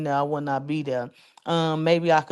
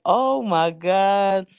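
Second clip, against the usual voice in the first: stunned; 4 dB louder, much slower than usual. Retold or imitated. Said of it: "I'm like".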